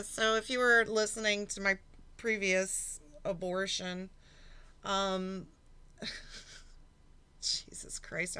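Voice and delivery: ordinary talk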